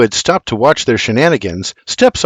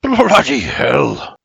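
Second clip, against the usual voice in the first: slow, drawn-out grumble; grumbling; much slower than usual. A narrator's reading. Man climbs from cold, wet wagon, grumbling. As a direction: groan the curse out wearily. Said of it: "he grumbles".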